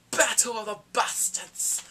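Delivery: loud, slow, announced like a movie title; big slow epic title call; dramatic announcement. Acted. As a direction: boom it out like a trailer voice.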